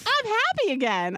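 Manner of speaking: put-on high, sweet child voice; imitating daughter; happy kid. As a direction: chirp it like a beaming toddler. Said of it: "She's like".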